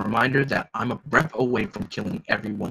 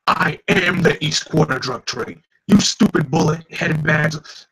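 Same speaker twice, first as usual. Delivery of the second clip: mild angry boss; 4 semitones higher, 6 dB louder, slower than usual. Acted. Drug lord insults.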